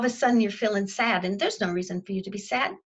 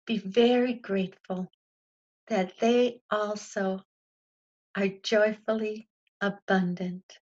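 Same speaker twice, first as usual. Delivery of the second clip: affirmation prayer; 3 semitones lower than usual. Acted. Teacher closing the group's affirmation with a prayerful amen. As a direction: intone it like a blessing, unhurried.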